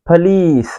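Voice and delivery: पुलिस said pəlees, stress on the second syllable; British pronunciation. Shown as a demonstration. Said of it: "British". (British उच्चारण)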